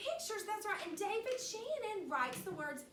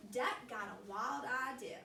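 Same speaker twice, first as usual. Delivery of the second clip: usual speaking voice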